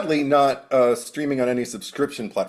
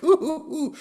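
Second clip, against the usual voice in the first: excited. Retold or imitated. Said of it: "I'm like".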